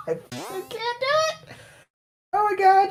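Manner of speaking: high giggling, breaking up; can't stop laughing; laughing hard. Real, not acted. Cracking up.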